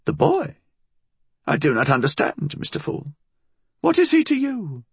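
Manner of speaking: put-on high-pitched old-woman voice; high, evasive old crone; wary old woman. A narrator's reading. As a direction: feign ignorance to an intruder.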